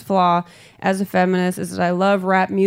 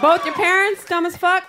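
mocking echo; 8 semitones higher than usual. Mocking the woman's question back.

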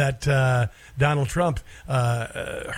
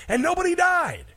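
nobody died pitched way up; sarcastic emphasis. Real, not acted. To listeners about his daughter's school, a high sarcastic punchline. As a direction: land the punchline high and mocking.

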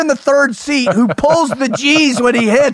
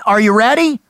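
ordinary talk, nothing marked

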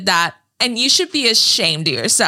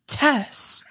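higher mock rebuke; 5 semitones higher than usual. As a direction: say it like a movie line, pitched up. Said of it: "[name] goes like this".

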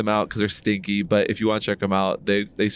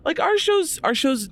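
much higher, strained voice; high-pitched; slower than usual. Real, not acted. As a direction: pitch way up while answering. Answering the future question.

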